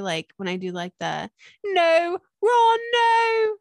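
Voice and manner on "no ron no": high, loud British wail; British accent. Acted. Quoting Harry Potter to prove she can, hammed-up British accent. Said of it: "I can do a British accent if I start quoting Harry Potter".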